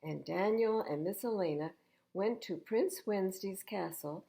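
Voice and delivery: ordinary delivery, nothing marked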